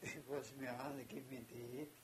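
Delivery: ordinary delivery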